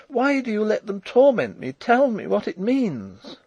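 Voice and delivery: high, loud, anguished demand; crying out. A narrator's reading. Princess demanding truth from Rudolf, crying out. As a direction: plead high and forcefully for an answer. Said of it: "she cried".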